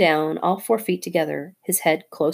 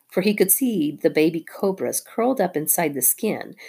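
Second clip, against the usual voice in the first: ordinary delivery, nothing marked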